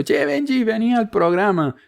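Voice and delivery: Benji, call him over brightly, high and friendly; invitación amable. Shown as a demonstration. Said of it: "No le voy a decir". (invitación amistosa, mucho más aguda)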